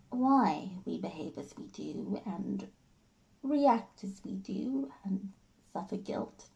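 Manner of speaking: hurt wife holding herself in; measured, halting confrontation with pauses; Jenny. Acted. Jenny concedes she is not as smart as George, restrained hurt.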